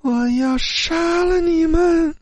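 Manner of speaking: very high, slow, drawn-out ghostly threat; an eerie female ghost threatening death. A narrator's reading. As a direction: wail the threat from beyond. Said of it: "女鬼的声音回荡在寝室里".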